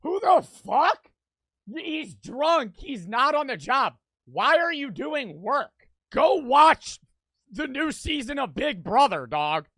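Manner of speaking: loud, high outraged yelling; shouting. Real, not acted. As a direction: yell at the screen in disbelief. Yelling at the clip.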